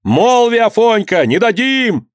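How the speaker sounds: high-pitched urgent calls; narrated cries of many voices; crowd shouting. A narrator's reading. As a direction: call out from the crowd to stop them. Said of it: "кричали голоса".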